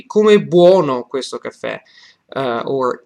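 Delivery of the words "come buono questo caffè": "Come buono questo caffè" with a loud, raised BUONO; sentence stress. Shown as a demonstration. Teacher repeating the example, strong emphasis on "buono" in "Come buono questo caffè". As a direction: hit "buono" hard and ease off on "questo caffè". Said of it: "the sentence will be".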